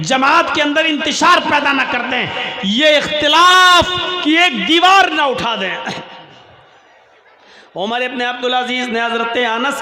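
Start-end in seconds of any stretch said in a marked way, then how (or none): 0.0-5.8 alarmed complaint. Retold or imitated. People warn the caliph of division, rising declamatory complaint. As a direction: build to a louder, ringing warning. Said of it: "लोगों ने शिकायत की".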